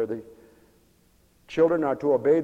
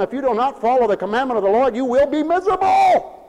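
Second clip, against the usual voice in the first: higher-pitched warning building to a shout; full-force preaching; 8 semitones higher, 6 dB louder than usual. Real, not acted. Preacher warning the congregation to obey, full-force preaching. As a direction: build it up to a shouted last word.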